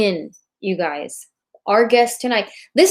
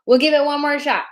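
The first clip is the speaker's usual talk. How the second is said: ordinary delivery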